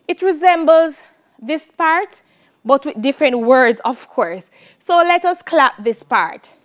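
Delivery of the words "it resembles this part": ordinary delivery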